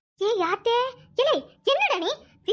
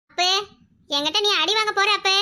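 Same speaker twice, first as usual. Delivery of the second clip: ordinary talk, nothing marked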